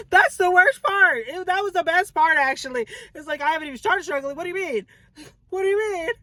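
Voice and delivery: high, whiny, half-crying mock protest; comic squeal of disbelief; mock dismay. Mock panic at the Lord's answer.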